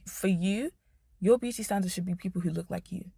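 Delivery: ordinary delivery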